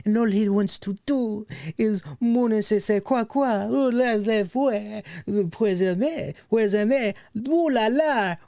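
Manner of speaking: high-pitched, hammed-up French accent; exaggerated French accent, drawn-out flourishes; mock French accent. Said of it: "you're ditching the French accent".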